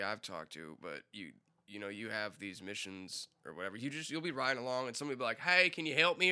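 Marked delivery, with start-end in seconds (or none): 5.4-6.3 higher, louder call for help; NPC plea. Retold or imitated. A roadside stranger calling to the player, high and loud. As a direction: hail the rider like a stranded stranger.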